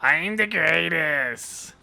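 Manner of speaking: slow, lightly voiced braggart; mock boast. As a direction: ham up the self-praise slowly. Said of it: "it's like".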